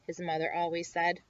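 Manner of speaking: ordinary delivery, nothing marked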